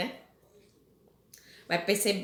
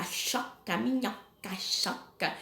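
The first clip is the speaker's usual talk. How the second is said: "choca" sussurrado, sem voz; sussurrando a rima; 2 semitones lower, 7 dB quieter, slower than usual. Shown as a demonstration. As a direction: cochiche "choca" ecoando a rima. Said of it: "são os sons que lembram". ("choca" dito em sussurro)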